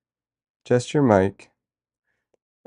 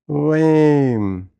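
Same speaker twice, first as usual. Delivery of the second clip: disappointed; 5 semitones higher, 6 dB louder than usual. Real, not acted. Chat not supported, disappointed.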